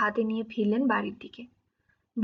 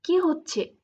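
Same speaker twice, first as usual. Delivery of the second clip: sharp high scold, stopping her; scolding snap; 5 semitones higher, much slower than usual. A narrator's reading. Wife Bilu snapping at sister to stop, scolding. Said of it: "Bilu ধমক দিয়ে বলল".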